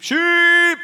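high, loud, stretched-out holler; shepherd's call. Retold or imitated. Shepherd calling his sheep to come, long high holler. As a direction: call the flock in with one long yell. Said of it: "how the farmer would call the sheep".

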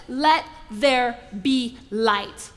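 slow, unhurried, calm command. Acted. Preacher voicing God at creation, slow and calm. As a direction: let each word land slowly and calmly.

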